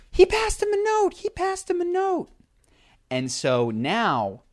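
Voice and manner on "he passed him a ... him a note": put-on high, loud child tattling; a kid snitching, pitched up high; nine-year-old boy. Boy shouting to Jim Jones that a note was passed, high and loud. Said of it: "a nine-year-old boy goes".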